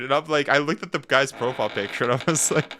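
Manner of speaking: ordinary talk, nothing marked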